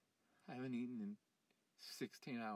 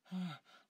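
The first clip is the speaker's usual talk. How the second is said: sharp, high exhale of panic; loud panicked sigh; 7 semitones higher, 6 dB louder than usual. Acted. Panicking man sighs loudly at the wall message.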